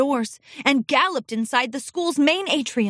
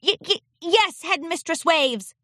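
put-on higher child voice, stuttering; stuttering scared colt; 6 semitones higher than usual. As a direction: stammer out of fear. Frightened colt answering.

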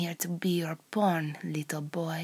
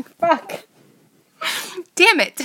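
real frustrated cry, higher and louder; frustrated outburst; 11 semitones higher, 9 dB louder than usual. Real, not acted. Performer cursing at her own slip, loud and frustrated. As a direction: blurt it out, annoyed at herself.

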